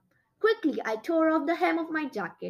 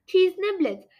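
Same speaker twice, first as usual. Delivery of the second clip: plain reading voice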